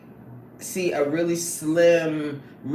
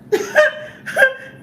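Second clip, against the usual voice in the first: high, loud burst of laughter; real high-pitched cackling laugh; 7 dB louder than usual. Real, not acted. Amused by chat comments.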